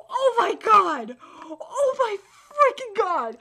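loud, drawn-out amazed exclamation; awestruck shout. Real, not acted. Reader reacts to manga battle, loud astonishment. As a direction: stretch each word out loudly in disbelief. Fan shocked by the fight.

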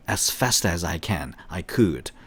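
slow model reading. Shown as a demonstration. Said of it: "이런 식으로".

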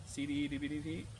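quick run of letters, rising at the end. Shown as a demonstration. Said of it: "A little faster".

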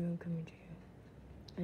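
slow spooky whisper, I'm coming to you; creepy whisper. Pretend. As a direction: breathe it out slowly like a stalker. Taunting game character, whispered take.

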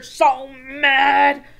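loud mock-mad burst; acted anger. Teacher telling children when to calm down, hammed-up anger.

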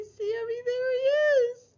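high, loud happy cry; excited. Acted. Teacher shows the kids she found Sammy, excited. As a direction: burst out with delight at finding him. Finding puppet Sammy at end.

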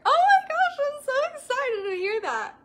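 overjoyed coach gushing at a client's news; real excited squeal. Real, not acted. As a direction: burst out with real joy, then laugh. Hears her client's good news.

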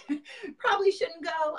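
high, half-laughing mock doctor; doctors' warning. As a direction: lightly imitate a wincing doctor. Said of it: "they were like".

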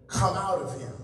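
firm, commanding come out of him; stern. Acted. Jesus commanding the demon, sternly. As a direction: order the spirit out. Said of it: "Jesus said sternly".

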